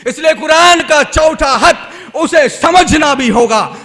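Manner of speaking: loud, driving sermon voice; fervent preaching. Acted. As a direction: preach it out to the crowd. Preacher exhorts loudly.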